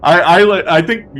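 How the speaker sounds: loud, high, spooked reaction; real fright. Real, not acted. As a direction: blurt how creepy that was. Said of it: "I literally got chills".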